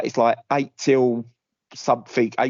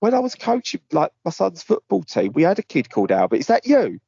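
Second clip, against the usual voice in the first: mock eager; 7 semitones higher than usual. Pretending to know the caller.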